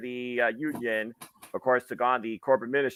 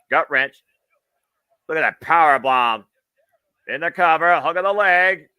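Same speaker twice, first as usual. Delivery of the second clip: rising excited play-by-play call, higher and louder; wrestling commentator; 6 semitones higher, 7 dB louder than usual. Real, not acted. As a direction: call the big moves as they land. Live play-by-play.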